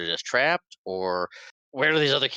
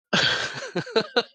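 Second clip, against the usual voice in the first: high awkward laugh. Real, not acted. Nervous laugh at sad scene.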